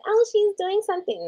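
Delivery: high, sing-song suspicious voice; imitating nosy viewers; mock accusing viewers. Mocking viewers who might accuse her, high sing-song. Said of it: "you guys can't be like".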